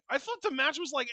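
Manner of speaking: ordinary talk, nothing marked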